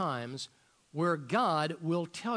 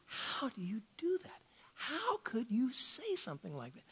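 breathy wondering whisper, much softer; hushed whisper; 3 semitones higher, 6 dB quieter than usual. Real, not acted. Preacher to congregation, asking how to obey, whispered. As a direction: drop to a whisper as if confiding the doubt.

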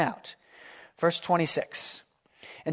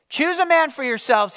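raised, higher battle call; Goliath's challenge cry; 7 semitones higher, 3 dB louder than usual. Acted. Giant challenging Israel's army, loud raised cry. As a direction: call out the challenge across the valley. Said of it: "he cries".

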